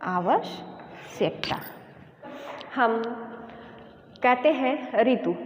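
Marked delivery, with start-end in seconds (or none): 0.0-1.6 आवश्यकता very slow and soft, every syllable clear; slow correct pronunciation. Shown as a demonstration. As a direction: teach आवश्यकता slowly and softly. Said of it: "इसका शुद्ध रूप क्या होगा". (आवश्यकता धीरे और नरम, शुद्ध रूप)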